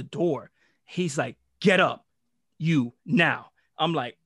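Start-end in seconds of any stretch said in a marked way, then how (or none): 1.6-3.5 loud furious command; angry order. Retold or imitated. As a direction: order him up on the spot. Principal storms in.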